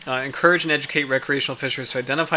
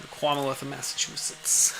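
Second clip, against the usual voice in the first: put-on "Commonwealth" in a soft Boston accent, said kwahmuhwealth, with the l dropped; a quieter, playful Boston accent on "Commonwealth"; 7 dB quieter than usual. Streamer savouring the Boston accent from a movie. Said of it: "fun to hear them say that in an accent".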